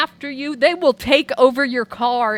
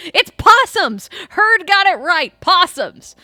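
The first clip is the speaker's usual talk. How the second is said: high, loud triumphant announcement; loud and slow "It's possums!"; excited reveal; 6 semitones higher, 8 dB louder, slower than usual. Real, not acted. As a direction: announce the answer with glee. Revealing quiz answer.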